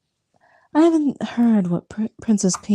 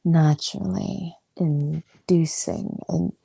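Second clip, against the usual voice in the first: seductive voice; 2 semitones lower, slower than usual. Real, not acted. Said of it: "born with this naturally inducing ... leering voice".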